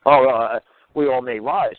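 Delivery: usual speaking voice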